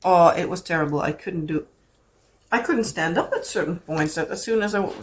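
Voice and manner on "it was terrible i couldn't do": normal talking voice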